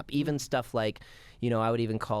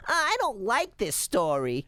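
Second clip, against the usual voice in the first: high, slow mock child voice; child's complaint; 7 semitones higher, 3 dB louder, slower than usual. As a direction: whine it like a bored kid. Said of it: "then she's like".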